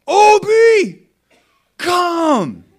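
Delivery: put-on very high, loud little boy's command; a small boy calling out the dog, trying to sound big. Little son commanding the family dog in his big boy voice. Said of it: "in his big boy voice".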